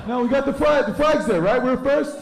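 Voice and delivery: high brash farewell to the moon; high loud silly voice. Acted. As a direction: belt it out like a cheesy cartoon guy.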